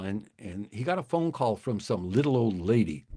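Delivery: ordinary talk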